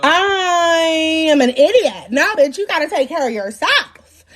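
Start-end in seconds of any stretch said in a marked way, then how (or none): 0.0-2.1 long stretched first word; slow, exaggerated admission; drawn-out self-reproach. Real, not acted. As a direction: drag it out slowly for comic emphasis.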